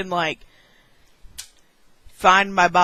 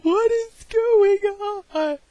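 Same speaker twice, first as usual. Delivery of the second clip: high, slow, bewildered voice; bewildered squeak; 12 semitones higher, slower than usual. Retold or imitated. Girl asking her laughing mom, high and slow in confusion. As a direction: stretch it out high and lost. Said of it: "and I'm like".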